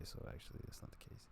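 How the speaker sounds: hushed aside. Real, not acted. Trailing whisper.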